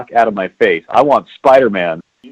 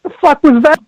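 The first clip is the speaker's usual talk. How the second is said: high baffled outcry; mock the whole crowd's confusion; baffled audience; 14 semitones higher than usual. Moviegoers baffled after the trailer, high and incredulous. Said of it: "they go".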